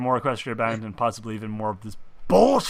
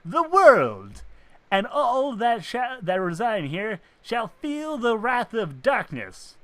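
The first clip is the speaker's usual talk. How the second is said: slow, higher villain proclamation; booming echoing villain monologue; 7 semitones higher, slower than usual. Acted. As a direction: proclaim doom like a dark lord. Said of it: "the voice you gave that character".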